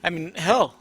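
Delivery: ordinary delivery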